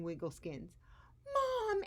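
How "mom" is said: high, loud cry for mom; shout. Acted. Edwin spots his parents, shouting. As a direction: call out with relief. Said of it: "Edwin shouted".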